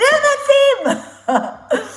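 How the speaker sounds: high, loud retold exclamation; excited recognition. Confirming to a woman who Peter is, high excited exclamation.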